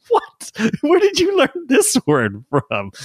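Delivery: high, slow astonished question; dad reliving his surprise at his son's word; mock shock. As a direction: act out being scandalized.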